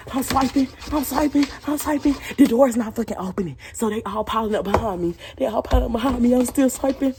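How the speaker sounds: high, loud frantic storytelling; telling friends about the stuck door, frantic and loud; panicked retelling. Retold or imitated. Frantic escape from a bear.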